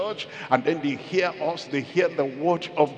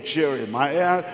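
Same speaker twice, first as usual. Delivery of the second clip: normal talking voice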